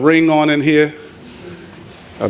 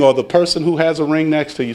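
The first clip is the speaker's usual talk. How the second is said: normal talking voice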